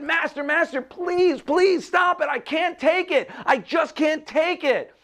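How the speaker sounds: very high, frantic pleading; desperate begging. A narrator's reading. Spinning clay to its master, desperate high plea. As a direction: beg for it to stop, straining upward.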